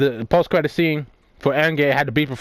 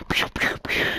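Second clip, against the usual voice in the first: pew pew sounds; 6 dB quieter than usual. Retold or imitated. Imitating Captain Marvel's blasts.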